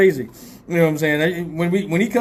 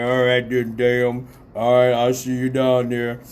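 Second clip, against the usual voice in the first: put-on deep voice; Mister Motherfucker; 3 semitones lower, slower than usual. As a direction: mimic a man with a very low phone voice. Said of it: "when I do the motherfucker voice".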